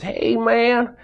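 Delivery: high, raspy, doped-up plea for water; lightly acted raspy patient begging. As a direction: croak it out like a drugged wounded soldier. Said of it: "he says in a raspy voice".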